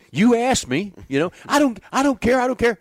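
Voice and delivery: high, loud protest, throwing up his hands; shouting back. Retold or imitated. Pitching coach hollering back at Bobby in the dugout. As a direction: yell it right back at him.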